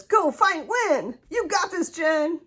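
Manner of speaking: high, loud cheerleader chant; friend's card cheering her on, loud and high; Laura mode cheer. Retold or imitated. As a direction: cheer it on like a pep squad. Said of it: "in Laura mode".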